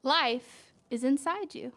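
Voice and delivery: slow, gentle picture-book read; reading to a child. A narrator's reading. Parent reading a flower book to a child, gentle storytelling. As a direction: draw the child into the page.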